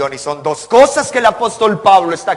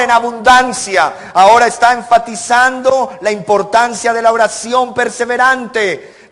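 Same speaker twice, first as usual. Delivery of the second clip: louder, higher insistent pulpit call; preaching at full force; 5 semitones higher, 5 dB louder than usual. Acted. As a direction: drive the point home to the brethren. Preacher insists word and prayer together. (predicando a toda voz, más alto y fuerte)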